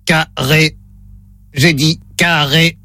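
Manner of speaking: voix de robot lente et grave; robot monocorde, très lent. Pretend. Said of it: "Voix de robot enclenchée". (voix de robot lente)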